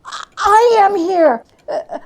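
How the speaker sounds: high, breathless joy; giddy relief. Acted. Scrooge finding himself home in bed, giddy and high. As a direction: bubble over with disbelieving delight.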